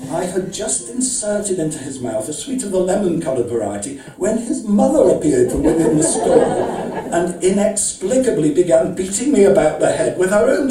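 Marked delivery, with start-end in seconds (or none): 4.2-10.7 laughing while reading. Real, not acted. Breaks up at the climax.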